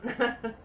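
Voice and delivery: brief amused laugh; a quick real chuckle; short real laugh. Real, not acted. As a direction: laugh despite yourself. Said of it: "I'm laughing".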